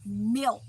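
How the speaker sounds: milk said slowly with a clear L; slow down and say milk carefully; careful proper milk, not merc. Shown as a demonstration. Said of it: "slow it down and say".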